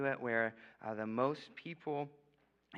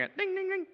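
high ding sound; 4 dB louder than usual. Retold or imitated. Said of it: "I was like".

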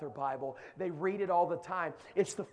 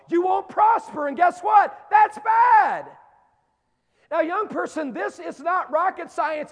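high, loud shouting sermon; full-force preaching; 9 semitones higher, 10 dB louder than usual. Acted. Preacher shouting to young people about God's promise, climbing high and loud.

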